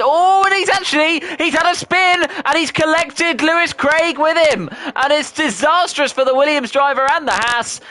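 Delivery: high, loud burst at a crash; shocked excited shout. Real, not acted. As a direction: yell out the spin in shock. Sudden spin, shocked race call.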